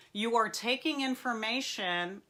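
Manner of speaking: ordinary talk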